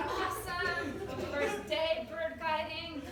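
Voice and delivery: high, loud, slowing celebration; acted triumph. Celebrating with the tour group.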